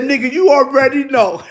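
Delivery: laughing through the words. Retold or imitated. To his son about the rat, laughing knowingly. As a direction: chuckle it out knowingly. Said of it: "I said".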